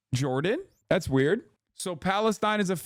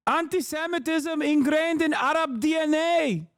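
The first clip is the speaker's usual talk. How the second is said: high-pitched, hammed-up Arabic-accented impression; mock Arabic accent; 5 semitones higher than usual. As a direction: parody the guest in a thick Arabic accent. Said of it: "He just sounds like that".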